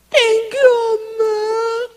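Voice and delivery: very high, long-drawn wail; mock tearful thanks. Daughter thanking mom after forgiveness, wailing. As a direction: bawl the thanks like a child.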